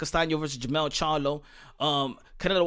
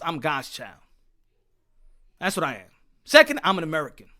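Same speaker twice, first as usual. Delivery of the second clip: normal talking voice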